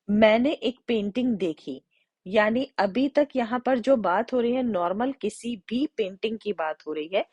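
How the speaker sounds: normal talking voice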